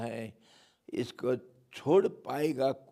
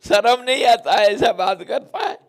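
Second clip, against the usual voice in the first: much louder, higher playful scolding; scolding mother; 10 semitones higher, 13 dB louder, faster than usual. Acted. Yashoda scolds naughty Kanha, loud and high. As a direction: scold the naughty boy loudly. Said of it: "Yashoda मैया डांटी".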